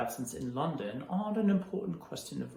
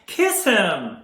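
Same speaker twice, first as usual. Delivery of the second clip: higher, louder insistent cry; loud urgent plea; 6 semitones higher, 9 dB louder than usual. Acted. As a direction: push the plea harder. Urging Irma, repeated stronger.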